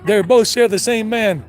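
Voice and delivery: loud, laugh-filled explaining; laughing while explaining. Real, not acted. Laughing as he explains the vendor's joke to viewers. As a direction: push through the laughter.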